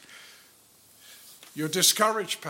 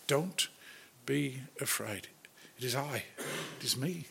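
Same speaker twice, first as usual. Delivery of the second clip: tender; 3 semitones lower, 12 dB quieter than usual. Retold or imitated. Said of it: "speaking tenderly".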